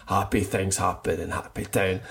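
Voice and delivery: low, soft reply in a playful chant; dad's routine answer. Retold or imitated. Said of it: "he's just like".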